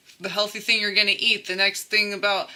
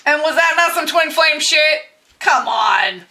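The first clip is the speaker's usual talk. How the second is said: mock disbelief; 7 semitones higher, 6 dB louder than usual. Real, not acted. Mock challenge to the audience.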